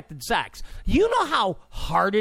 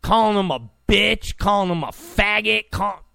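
ordinary delivery

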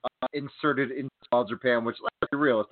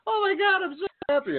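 mocking squeal of a thrilled fan; high-pitched fake ecstasy; gushing fans; faster than usual. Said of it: "all these Yoshihashi fans".

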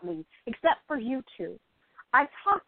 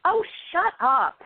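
embarrassed brush-off; 5 semitones higher, 4 dB louder than usual. Acted. Friend teases her acting talent.

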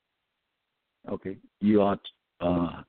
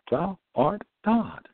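preaching proclamation; 11 semitones higher, slower than usual. Real, not acted. Preached proclamation, high and slow.

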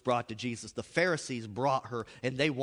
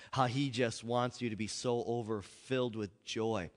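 usual speaking voice

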